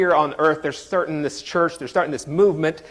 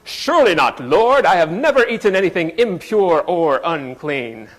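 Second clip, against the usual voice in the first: hammed-up Shakespearean stage voice, louder; Elizabethan English; 4 dB louder than usual. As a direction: Peter as an Elizabethan actor, theatrical and lofty. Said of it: "He's usually Elizabethan in my head".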